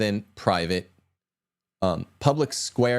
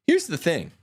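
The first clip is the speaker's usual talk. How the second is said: ordinary talk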